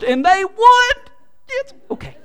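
high, loud burst of delight; excited outburst. Real, not acted. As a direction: blurt out the win. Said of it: "That wasn't a mutter either".

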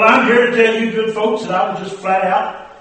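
normal talking voice

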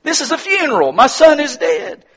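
high, loud indignant protest; acted grieving protest. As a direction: cry out as an outraged mourner. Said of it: "You think to yourself".

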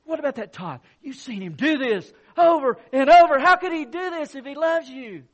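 loud, high accusing voice of Satan. Retold or imitated. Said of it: "and he says".

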